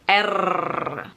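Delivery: long R held with a curled tongue; American R. Shown as a demonstration. Teacher introduces the American R sound, drawn out. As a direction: draw out the R, unrolled, American style. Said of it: "let's start with the sound of R".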